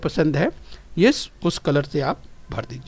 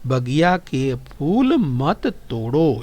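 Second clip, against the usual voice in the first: slow recitation; 3 semitones lower, much slower than usual. Shown as a demonstration. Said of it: "इसका वाचन किया".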